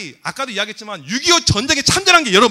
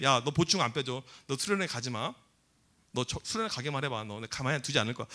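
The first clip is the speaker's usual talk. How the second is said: low, quiet teacher threat; teacher's threat; 11 semitones lower, 11 dB quieter than usual. Acted. Teacher warns a student off the retreat, quietly threatening. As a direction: keep the threat low and restrained.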